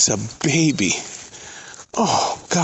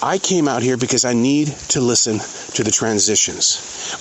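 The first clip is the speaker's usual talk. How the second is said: ordinary talk, nothing marked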